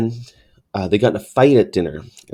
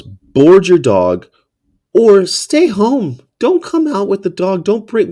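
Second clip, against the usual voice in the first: exasperated rant; 8 semitones higher, 4 dB louder, slower than usual. Real, not acted. Rant at dog owners.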